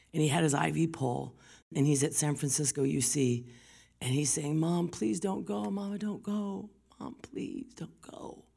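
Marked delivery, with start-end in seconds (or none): 4.6-8.4 high, drawn-out plea fading to whisper; imitated tearful pleading. Son begging his mother not to leave, imitated weeping plea. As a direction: beg slowly, the voice giving out. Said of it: "weeping and sobbing for his mother".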